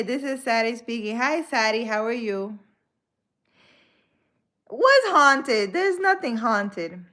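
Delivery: ordinary talk, nothing marked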